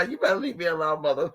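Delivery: ordinary talk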